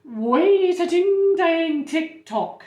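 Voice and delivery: slow, high, loud call to halt; storybook shout to stop everyone; shouting nurse. A narrator's reading. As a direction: shout it with the words drawn out. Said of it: "shouted the nurse".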